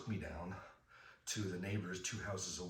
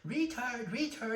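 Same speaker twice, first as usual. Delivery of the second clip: much higher, louder sing-song jeer; taunting kids; 7 dB louder, slower than usual. Retold or imitated. Schoolkids jeering at the boy, high loud sing-song taunt. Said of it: "They were like".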